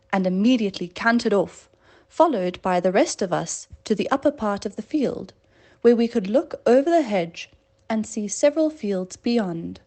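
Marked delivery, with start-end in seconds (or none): none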